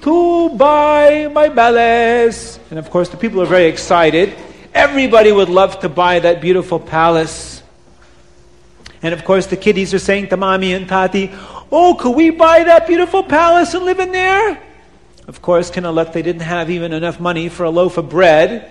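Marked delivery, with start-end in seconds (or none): 0.0-2.6 put-on high, slow accented seller's call; Abdallah calling out. 11.7-14.6 put-on much higher child's plea; children pleading.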